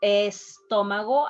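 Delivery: "estómago" slowly, with a clear E at the start; "estómago" in careful Spanish, leading E fully voiced; every letter pronounced. Shown as a demonstration. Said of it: "I pronounce estómago".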